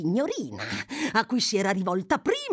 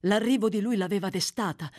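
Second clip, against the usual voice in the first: plain reading voice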